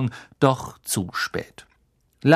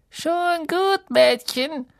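very high, put-on witch voice; high-pitched character voice, reassuring; trolley witch; much slower than usual. A narrator's reading. Trolley witch reassures Hermine, high put-on voice. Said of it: "sagte die Hexe".

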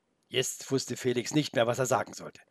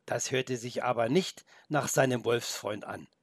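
plain reading voice

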